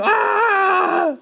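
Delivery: long, high shouted "aah"; imitated scream. Survivor still screaming while being pulled out, imitated scream. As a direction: re-enact nonstop screaming at full pitch. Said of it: "Y yo seguía gritando".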